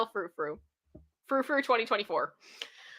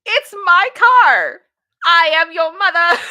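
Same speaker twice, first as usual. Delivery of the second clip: high, loud, slow mock entitled mom; mocking the mother; 8 semitones higher, 12 dB louder, slower than usual. Mocking the entitled mother to the co-host, hammed-up. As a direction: ham up the bratty mother.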